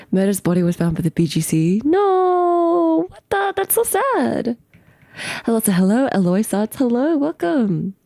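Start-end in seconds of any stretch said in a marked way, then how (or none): 1.8-4.6 high, drawn-out whine; high, slow mock-heartbroken cry; dismayed wail. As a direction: wail it in exaggerated sorrow. Hears the character's body was found.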